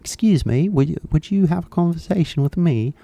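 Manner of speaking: high-pitched put-on British accent; politely approach someone in a fake British accent; British English. Interviewer stopping people on a Japanese street, fake British accent. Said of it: "なんかイギリス英語で".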